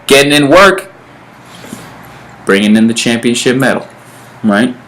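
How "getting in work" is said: ordinary talk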